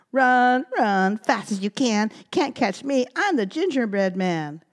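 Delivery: loud, high taunting chant; sing-song brag of a cookie; gingerbread man voice. Acted. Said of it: "He ran out the door saying".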